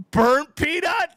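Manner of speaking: very high, drawn-out loud cry; mock-excited exclamation. Retelling his reaction to her favorite streamer, hammed-up excitement. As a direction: stretch the name out in an exaggerated high yell.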